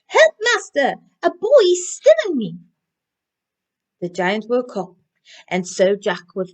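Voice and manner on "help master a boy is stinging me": high, loud panicked call for help; magic harp screaming for help; harp crying out. Acted. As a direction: shriek for your master to save you. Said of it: "the harp cried".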